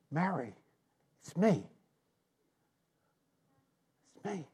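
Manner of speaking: barely audible, gentle reveal; tender hush. Acted. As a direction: whisper it tenderly to her. Jesus gently reveals himself.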